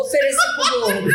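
high mocking voice with a laugh; mocking thief. The thief deciding to target the chubby guy, mocking and high. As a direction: sneer it out high and amused.

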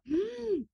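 sharp high gasp of horror; a sudden intake of breath at bad news; shocked gasp. Opening changed script pages.

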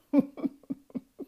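short loud chuckle; laugh. Real, not acted. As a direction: laugh at the joke. Laughs at own joke.

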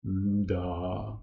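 низько, тихо, задумливо промимрити; протягнути низько, в роздумах; глибокодумне мимрення. A narrator's reading. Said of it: "глибокодумно промимрив". (глибокодумно промимрив)

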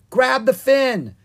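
high, loud urgent order; yelled. A narrator's reading. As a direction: yell it across the water. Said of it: "Ella yelled".

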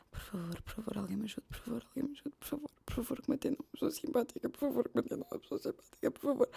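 high, soft whimpering plea, fast in the middle; desperate pleading. Retold or imitated. Caller softly begging finance office for a kind person. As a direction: beg softly like someone desperate. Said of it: "que vocês já ligam assim".

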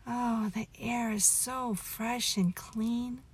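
ordinary delivery, nothing marked

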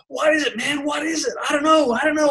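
high, pumped back-and-forth imitation; hyped-up bro. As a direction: mimic an excited mate straining to see. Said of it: "hyped up conversation".

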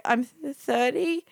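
high, drawn-out, unsure answer; hesitant admission. Retold or imitated. Hesitant answer to creepy driver.

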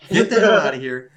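loud retold shout to back off; angry dismissal. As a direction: shout him away, acting it out. Retold quarrel, chasing him off.

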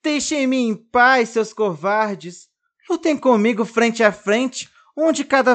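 struggling doll yelling at bullies; shouting. A narrator's reading. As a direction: shout at your captors to fight fair. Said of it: "Pretinho grita".

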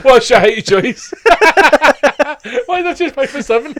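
teasing a friend about height; mock shout. Real, not acted. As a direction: yell the question high and loud in mock disbelief. Mocking height pick joke.

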